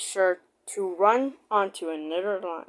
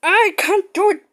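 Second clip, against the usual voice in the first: higher, louder puffing chant; 10 semitones higher, 6 dB louder than usual. Acted. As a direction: pant it out like a straining engine. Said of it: "puffed Gordon".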